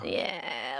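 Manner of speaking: normal talking voice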